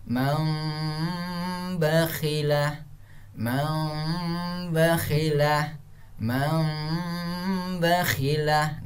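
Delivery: slow chanted "man bakhila", n hummed as m; measured Quran recitation, held m in "man bakhila"; correct iqlab recitation. Shown as a demonstration. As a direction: hum "man" into a long m before "bakhila". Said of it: "Yang benarnya cara bacanya".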